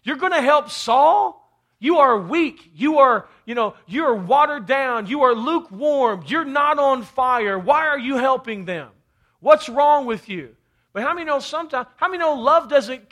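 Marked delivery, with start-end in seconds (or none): 0.0-10.6 mock accusing scold. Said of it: "been like".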